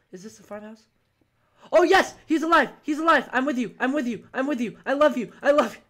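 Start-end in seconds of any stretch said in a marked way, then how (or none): 1.7-4.6 loud, high cry of relief; thrilled yelling; elated. Real, not acted. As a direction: shout it in joyful relief. Finds teammate alive.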